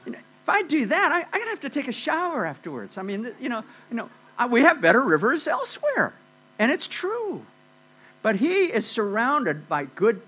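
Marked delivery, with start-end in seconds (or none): 0.0-6.1 high, outraged disbelief, much higher pitch; incredulous Naaman. Retold or imitated. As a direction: scoff at the muddy river like an offended general. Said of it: "He's saying".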